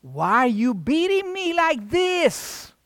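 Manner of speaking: donkey hurt by his master; talking donkey. Acted. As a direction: plead slowly as the hurt animal. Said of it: "And the donkey says".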